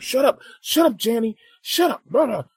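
high, imitated angry parent yell; mock yelling. Imitating his friend telling off the kids, mock yelling. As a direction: holler at the kids, hammed up. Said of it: "he's, like, yelling at the kids".

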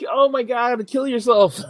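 loud, high exasperated outburst; mock exasperation. Recalling his reaction to the joystick player, mock exasperated. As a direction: exclaim in exaggerated disbelief, half laughing.

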